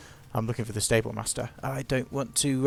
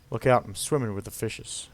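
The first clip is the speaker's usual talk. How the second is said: put-on terrible American accent; 3 semitones lower than usual. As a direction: put on a bad American movie-line accent. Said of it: "I apologise to all Americans".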